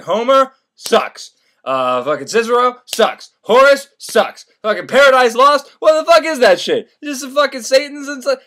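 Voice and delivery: loud, high dismissal of each item; scornful rant. Retold or imitated. Senator trashes his library, loud and scornful. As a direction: rattle off the verdicts loudly with disgust. Senator rants against classic books.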